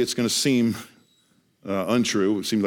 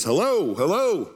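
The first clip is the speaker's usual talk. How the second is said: raised, higher calls of hello into the radio; urgent radio call; 4 semitones higher, slower than usual. Retold or imitated. Panicked passenger calling into the plane radio, raised urgent hello. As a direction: call out for help, each hello falling.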